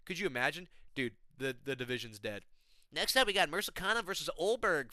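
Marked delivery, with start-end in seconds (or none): none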